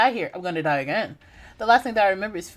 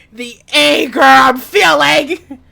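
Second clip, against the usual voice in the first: mock fury; 5 semitones higher, 13 dB louder, much slower than usual. Gamer repeats anger line loud.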